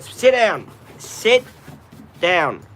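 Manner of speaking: loud, high command to the dog; sharp dog-training order; firm command. Real, not acted. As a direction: tell the dog to sit, firmly.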